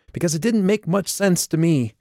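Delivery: shrill. A narrator's reading. Calliope confronting Joshua about his message, shrill and loud. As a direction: keep the voice up, sharp and strained. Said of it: "Calliope's voice sounded shrill in her own ears".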